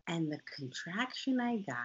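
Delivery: normal talking voice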